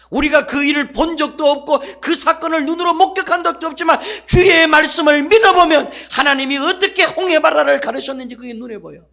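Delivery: high, loud, passionate sermon climb; preacher builds to a loud climax; fiery preaching. Acted. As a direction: preach it with rising fervour. Preaching at full force.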